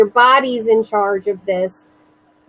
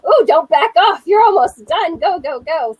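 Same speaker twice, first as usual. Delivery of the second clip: higher and louder, urging herself on; pumped up cheer to keep going; self cheering; 7 semitones higher, 4 dB louder than usual. Retold or imitated. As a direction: coach yourself through the push. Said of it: "I was like".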